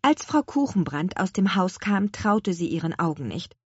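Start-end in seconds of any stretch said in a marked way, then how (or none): none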